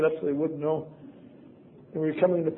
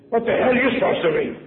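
loud, higher jeering question; mocking shout; 7 semitones higher, 6 dB louder than usual. Shipmates jeering at the fifteen-year-old newcomer, loud mocking. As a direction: sneer at the scrawny new kid. Sailors mocking the new kid.

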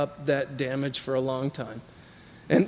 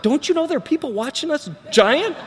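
pitched-up, mock-exasperated aside to the giant; mock incredulous; 10 semitones higher than usual. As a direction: ham up the embarrassed disbelief. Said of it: "It's like".

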